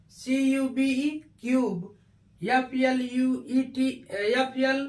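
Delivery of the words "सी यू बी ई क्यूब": "क्यूब" said as kyoob, the u as yoo; letter by letter, then "क्यूब" with a yoo sound; spelling then word. Shown as a demonstration. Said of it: "यू हो जाता है".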